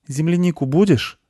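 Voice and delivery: put-on high boy's question; high-pitched young boy asking gently; little boy. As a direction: ask in a high child voice. Boy offers strawberries.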